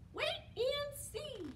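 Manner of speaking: very high little mouse voice; reassuring mouse. A narrator's reading. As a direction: comfort Bear in a tiny squeak. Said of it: "said Mouse".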